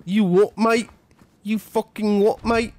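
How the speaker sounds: high-pitched mock British lout; hammed-up British "oi, mate" challenge; terrible British accent. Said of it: "really terrible British accent".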